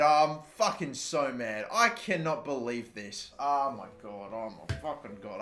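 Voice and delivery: low, soft stunned disbelief; real deflation; deflated despair. Real, not acted. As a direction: sink into defeat.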